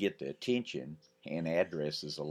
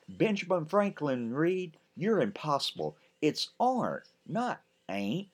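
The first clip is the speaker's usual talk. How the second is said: high, prim correction; big sister correcting grammar; teacherly scold; 11 semitones higher than usual. Acted. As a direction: scold him like a schoolteacher.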